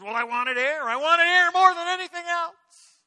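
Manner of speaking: high, loud, urgent; desperate answer; gasping young man. Acted. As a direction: blurt it out still breathless. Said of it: "the young man answered".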